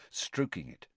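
usual speaking voice

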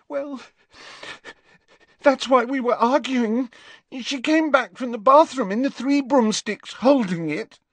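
put-on high, tearful girl's voice breaking into crying; a frightened friend near tears; high girl voice, sobbing at the end; distraught girl.

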